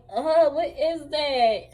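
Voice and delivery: slow, drawn-out shocked question; slowed, stretched-out retold shock; mock disgusted surprise. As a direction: recoil from the gushy stuff. Fluid gushing out.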